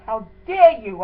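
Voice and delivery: sharp, loud, outraged challenge; indignant outburst. Retold or imitated. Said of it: "No, I have to say them the way I said it".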